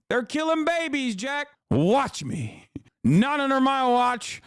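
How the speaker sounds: put-on high, cranky old-man voice; Biden impression. Streamer mocking Biden vowing a crackdown, high and cranky. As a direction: play the tough old president. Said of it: "being like".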